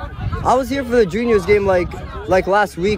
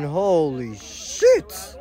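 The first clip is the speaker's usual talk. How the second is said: normal talking voice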